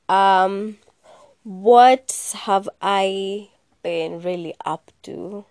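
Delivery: usual speaking voice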